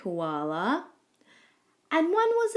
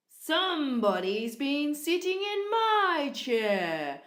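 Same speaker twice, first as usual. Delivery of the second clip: slow, big growl swelling on "my"; growling daddy koala; 5 semitones higher, slower than usual. A narrator's reading. Daddy koala finding his toast eaten, growling. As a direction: growl it out, drawn out and indignant. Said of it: "growled Daddy Koala".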